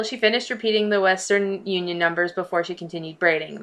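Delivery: ordinary talk, nothing marked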